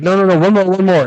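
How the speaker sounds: mock friend refusing to let up; lightly hammed-up insistence; insisting friend.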